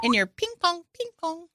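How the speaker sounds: high squeaky silly voice; goofy high-pitched euphemism. Pretend. Silly voice joking.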